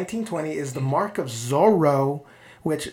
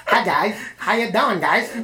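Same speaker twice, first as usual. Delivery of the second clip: higher, louder goofy put-on voice; Charlie Chaplin; 5 semitones higher, 5 dB louder than usual. Chaplin first greeting his audience, comic put-on voice. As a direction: greet the crowd in a silly high voice. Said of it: "what if, like, the first time he spoke".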